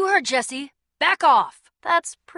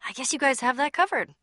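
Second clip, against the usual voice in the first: normal talking voice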